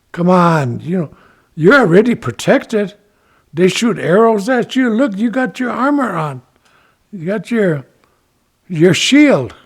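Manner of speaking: high, loud, coaxing flattery; bragging up. Acted. Ichiko persuades reluctant Turtle to join, bragging him up. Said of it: "he's really bragging up that turtle".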